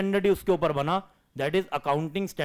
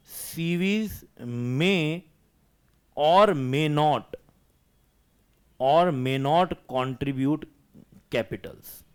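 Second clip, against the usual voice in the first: slow dictation; much slower than usual. Acted. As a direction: dictate word by word, with long pauses. Dictating notes to the class.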